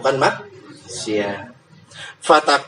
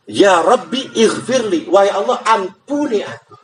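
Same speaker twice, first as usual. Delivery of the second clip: slow, drawn out plea; slow prayer; 5 semitones higher than usual. Shown as a demonstration. Preacher modelling the forgiveness prayer, slow and drawn out. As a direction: pray it humbly and slowly.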